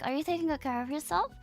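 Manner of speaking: ordinary talk, nothing marked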